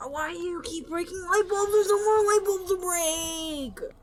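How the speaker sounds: high, whining protest swelling loud; distressed wail. Real, not acted. Player protesting broken bulbs to Grandma, distressed wail. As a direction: wail it, stretching the last words. Pleading with game ghost Grandma.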